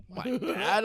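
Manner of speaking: high, disbelieving squeak; can't believe it's my dad; incredulous. Retold or imitated. Disbelief at dad's claim.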